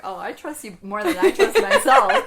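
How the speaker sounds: loud laughing quote. Retold or imitated. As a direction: laugh through the words. Quoting self, laughing loudly.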